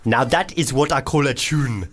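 put-on South African accent slipping toward Nigerian; wobbly African accent. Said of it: "is this going full African?".

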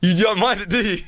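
mock teasing voice. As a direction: needle him in a silly voice.

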